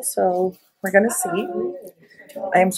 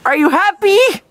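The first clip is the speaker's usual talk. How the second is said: loud exasperated; 11 semitones higher, 7 dB louder than usual. Real, not acted. Asks after painful touch-up.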